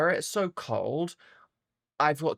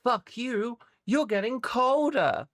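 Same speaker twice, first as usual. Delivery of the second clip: high taunting voice for the window; voicing the cold window, mocking her; 8 semitones higher than usual. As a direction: sneer like a spiteful window. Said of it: "meaning it's like".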